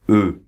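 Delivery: "E" with rounded lips, like O plus E; French mute e sound. Shown as a demonstration. Teacher showing students the vowel in "E". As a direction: hold a short rounded "E". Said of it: "on la prononce comme, euh, comme ça".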